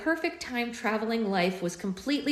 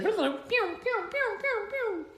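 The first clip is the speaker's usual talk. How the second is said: pew pew sounds; much slower than usual. Pretend. Sound effect imitation.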